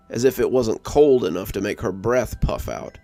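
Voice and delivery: ordinary delivery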